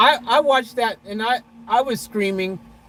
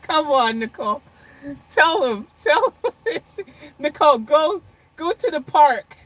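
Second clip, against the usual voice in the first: excited urging; 8 semitones higher, 4 dB louder than usual. Real, not acted. Urging Nicole to tell.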